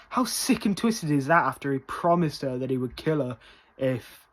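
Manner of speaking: ordinary delivery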